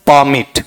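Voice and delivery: "परमिट" as PAA-mit, long first vowel; noun pronunciation. Shown as a demonstration. Teacher asking for the noun reading, noun pronunciation. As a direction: stress and stretch the PAA of "परमिट". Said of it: "noun में पढ़ेंगे तो".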